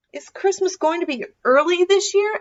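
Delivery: high, quick, eager little fox. Acted. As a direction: rush the question like an excited fox. Fox asks excitedly.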